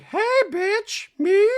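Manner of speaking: hammed-up high Dorit voice; mock Dorit. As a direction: squeal it like an offended diva. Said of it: "Dorit's like".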